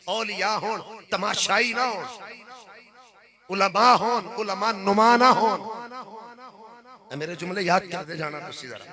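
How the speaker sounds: loud, ringing sermon voice; fiery pulpit delivery; full-force preaching. Acted. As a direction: preach it to the back row.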